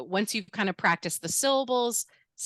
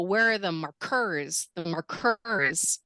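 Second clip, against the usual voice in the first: "markers" stressed on the second syllable, mar-KERS; wrong-syllable stress; slower than usual. Shown as a demonstration. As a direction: drag out the last syllable of "markers". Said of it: "that sounds way weirder".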